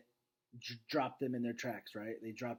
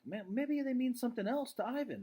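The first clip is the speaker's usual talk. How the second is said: higher voice; 10 semitones higher than usual. Retold or imitated. Teacher voicing Julia's thought.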